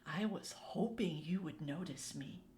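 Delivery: a soft, low creature. A narrator's reading. The anger creature first speaks.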